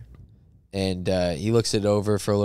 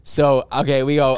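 ordinary talk

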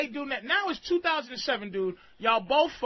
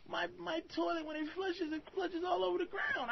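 higher, softer mock complaint; imitated complaining girlfriend; 6 semitones higher, 9 dB quieter than usual. Comedian voices girlfriend griping about the toilet, soft mock complaint. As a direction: lightly mimic her helpless fussing. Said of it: "for her to go".

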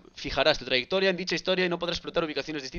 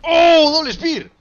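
ecstatic shout; 11 semitones higher, 12 dB louder, much slower than usual. Real, not acted. Double spear lands.

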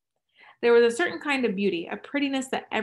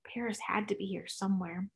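plain reading voice

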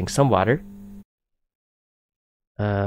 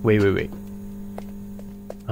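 hushed; 3 semitones lower, 9 dB quieter, much slower than usual. Real, not acted. Creeping cautiously in game.

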